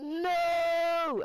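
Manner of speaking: high, loud anguished no; desperate cry. Retold or imitated. Begging friend not to reveal channel, loud high cry. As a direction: cry out to stop him. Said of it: "and I'm like".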